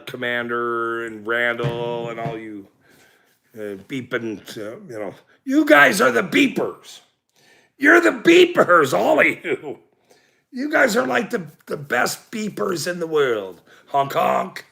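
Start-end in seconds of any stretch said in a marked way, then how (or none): 5.4-12.9 playful shout. Real, not acted. Playfully calling out fans.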